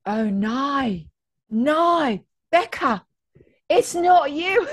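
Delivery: high, loud mock Australian dismay; badly faked Aussie TV-host horror; terrible Australian accent. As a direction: wail it in hammed-up Australian. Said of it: "a terrible Australian accent".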